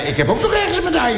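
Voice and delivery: hoge nagedane vrouwenstem; Erika nagedaan. Retold or imitated. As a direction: doe Erika na, hoog en gretig. Erika nagedaan, veel hoger. (hoge imitatie van Erika die opschept over haar medaille)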